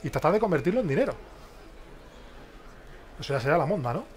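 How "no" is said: ordinary delivery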